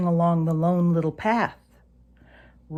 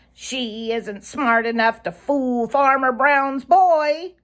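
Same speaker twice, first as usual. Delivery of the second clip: high, louder jeer; taunting; 7 semitones higher, 5 dB louder than usual. A narrator's reading. Sammy Jay taunts Reddy about Granny, high and jeering. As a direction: tease him with a sneer, pitched up. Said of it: "taunted Sammy".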